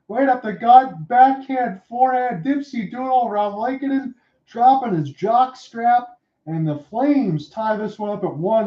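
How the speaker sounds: high, lively replay call; excited replay call. Real, not acted. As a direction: relive the goal with excitement.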